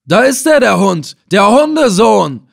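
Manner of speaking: high, loud furious scream. Acted. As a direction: scream the insult at the enemy. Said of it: "schrie Ibn Assel". (hoch und laut wütend schreien)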